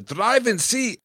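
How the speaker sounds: DRIVING SEAT drawn out, high and loud; "driving seat" stretched high for effect; emphatic flourish. Real, not acted. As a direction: belt out "driving seat" with a rising swoop. Big emphatic punchline on phrase.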